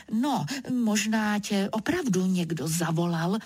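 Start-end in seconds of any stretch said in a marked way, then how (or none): none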